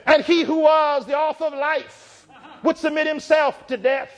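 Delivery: rising sermon cadence, hymn lines shouted; fervent pulpit delivery; preaching at full force. Acted. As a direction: preach it, building to a shout on how great thou art. Climactic sermon close, whooping.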